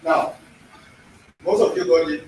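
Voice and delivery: ordinary talk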